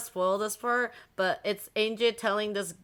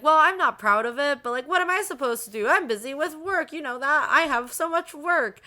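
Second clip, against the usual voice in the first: higher, rushed defensive protest; defensive excuses; 7 semitones higher, 4 dB louder than usual. Retold or imitated. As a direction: justify yourself to your accusing child. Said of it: "Rosa's like".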